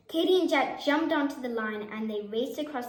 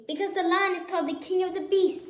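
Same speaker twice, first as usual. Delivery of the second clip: grand, boastful lion voice; proudly; 7 semitones higher, 4 dB louder, faster than usual. A narrator's reading. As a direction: puff up like the king. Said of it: "said the lion proudly".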